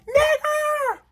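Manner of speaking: very high hollered shout from afar; mock shout. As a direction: yell it like a teen taunting from a car. Second louder holler from car.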